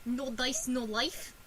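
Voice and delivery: low-pitched mock Russian; Russian accent. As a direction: put on a deep Russian accent. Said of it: "are you doing Russian?".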